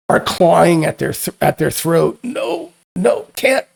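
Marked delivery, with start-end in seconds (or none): 2.2-3.7 very high, strained gasping voice; choking creatures. Acted. Creatures clawing their throats as the gas fades, choking. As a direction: squeak it out while choking.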